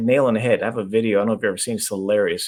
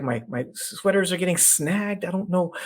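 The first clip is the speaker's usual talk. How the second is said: higher, baffled wife rambling; confused woman voice; 8 semitones higher than usual. Retold or imitated. Coach retelling the video, higher puzzled wife voice. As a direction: play her lost and puzzled. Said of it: "she's, like, confused".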